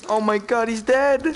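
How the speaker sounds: high, loud shocked gasp. Real, not acted. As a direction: react in sudden shock. Shocked at dead body.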